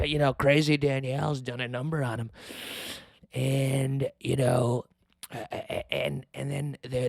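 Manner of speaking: normal talking voice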